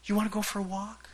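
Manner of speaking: lightly voiced wife asking for a walk; wife's voice. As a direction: imitate Kathy inviting him out. Wife's invitation, voiced by pastor.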